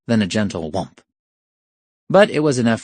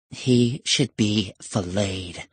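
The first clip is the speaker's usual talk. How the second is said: slow, softer pronouncement; vengeful; 5 dB quieter, much slower than usual. Acted. Septima plotting against the professor, slow and vengeful. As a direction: pronounce the sentence slowly and softly.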